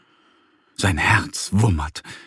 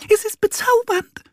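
tiny high mouse voices, dreamy; cooing mice. A narrator's reading. Mice swooning over the visitor, tiny and dreamy. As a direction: purr it sweetly like enchanted mice. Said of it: "säuseln die Mäuse".

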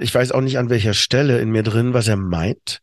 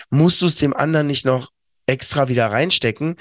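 ordinary talk

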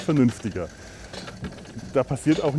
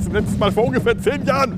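excited; 9 semitones higher, faster than usual. Real, not acted. Said while riding fast.

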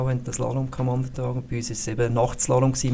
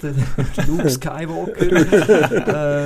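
laughing; 4 semitones higher, 6 dB louder, much slower than usual. Real, not acted. Laughing while naming the DJ.

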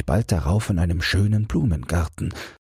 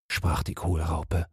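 usual speaking voice

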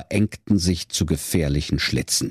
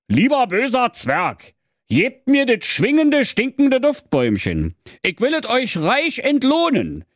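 high, pompous royal plea; a grand king begging for a prize; pleading king voice. Acted. King pleads with evil dwarf.

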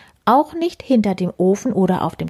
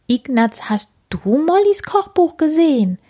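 high, louder fairy voice; fairy asking; 8 semitones higher, 3 dB louder than usual. Acted. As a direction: call to the worm brightly. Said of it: "fragt sie".

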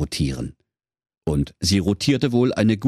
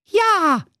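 sehr hohes, kleinlautes Zicklein-Ja; hoch und verlegen piepsen; zerknirschtes Geißlein; 3 dB louder than usual. Acted. (kleinstes Geißlein gibt zerknirscht zu)

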